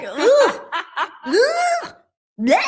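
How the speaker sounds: high, loud mock moan into a gag; mock lustful moan. Imitating the Booty Warrior moaning, then gagging.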